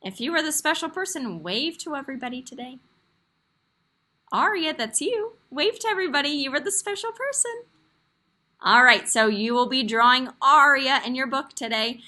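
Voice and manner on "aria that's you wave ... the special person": cheerful praise. Real, not acted. Teacher cheers special person.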